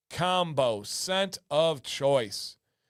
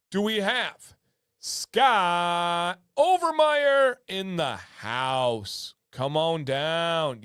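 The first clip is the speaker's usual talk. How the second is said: game show host; much slower than usual. Acted. Calling a winner up.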